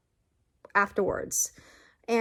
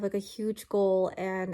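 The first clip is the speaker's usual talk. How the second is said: ordinary talk, nothing marked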